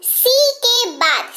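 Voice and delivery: slow down to make them notice; teacher stresses the rule slowly; slow emphasis. Shown as a demonstration. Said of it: "ध्यान से".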